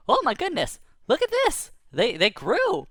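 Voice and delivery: very high, loud astonishment; amazed. Retold or imitated. Boy seeing the carrots grow, high and amazed. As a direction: wonder at the sight.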